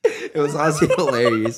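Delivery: loud, high, laughing delivery; helplessly amused after the joke; cracking up. Real, not acted. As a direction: say it through real laughter. Cracking up after the coach impression.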